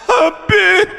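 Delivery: high, hammed-up sobbing plea; overdramatic wail. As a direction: fake a melodramatic cry to the Habib. (tangisan lebay dibuat-buat)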